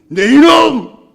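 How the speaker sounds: father roars his rebuke; slow booming bellow of rage; a father explodes at his son; furious shout. Retold or imitated. Angry father in story.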